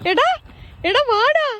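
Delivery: high, loud call to the dog. Real, not acted. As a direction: call him over loudly. Calling the dog. (നായയെ ഉറക്കെ വിളിക്കുന്നു)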